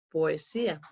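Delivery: "poesía" with a clear separate o before e; full hiatus. Shown as a demonstration. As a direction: say "poesía" as po-e-sía, every vowel apart. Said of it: "Yo no dije".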